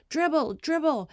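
high, called-out urging; retold sideline encouragement; cheering her on. Coach to timid girl with the ball, cheering. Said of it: "cheering her on and encouraging her".

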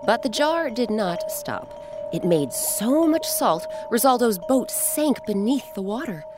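ordinary delivery, nothing marked

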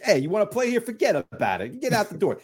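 high-pitched mock New York accent. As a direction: put on a stock New York tough talker. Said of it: "give him a New York accent".

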